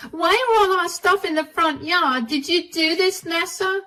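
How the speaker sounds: fuming at the sister who threw their things out. Acted. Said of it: "greeted by a fuming couple".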